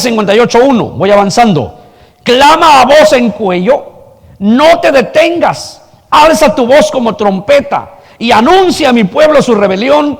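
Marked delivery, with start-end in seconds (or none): 2.2-10.2 raised high, ringing proclamation; full-voiced call of the prophet; proclaimed loudly. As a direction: cry it out like a trumpet. Preaching Isaiah at full force.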